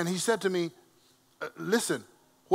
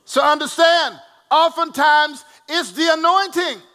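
preaching; 7 semitones higher, 6 dB louder than usual. Acted. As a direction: drive it home to the church. Preaching at full force.

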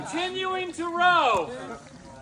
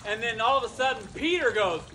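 plain reading voice

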